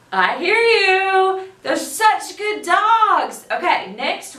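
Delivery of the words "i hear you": plain reading voice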